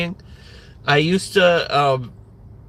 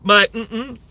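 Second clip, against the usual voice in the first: mock casual greeting; 8 semitones higher than usual.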